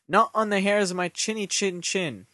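high-pitched storybook pig retort; pig's defiance. A narrator's reading. Pig refusing the wolf at the door, high defiant retort.

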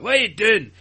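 loud, high mock Scottish accent; Jake. Podcaster mimicking a castmate's start, mock Scottish. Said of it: "he started off Scottish".

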